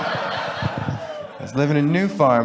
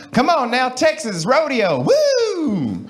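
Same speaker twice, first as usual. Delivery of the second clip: high, whooping Texas twang; 8 semitones higher, much slower than usual. Acted. Crowd egging on a Texan for Texas twang, exaggerated. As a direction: play up a Texas drawl, ending in a whoop. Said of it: "some of that Texas twang".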